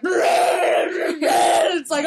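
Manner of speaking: loud, drawn-out howling monster cry; monster roar. Shown as a demonstration. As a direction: scream like a big lumbering beast. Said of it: "give a demonstration of the obscure monster noises".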